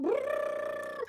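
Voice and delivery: high trilled drum-roll noise; mock drum roll. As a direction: imitate a drum roll with the mouth. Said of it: "Drum roll".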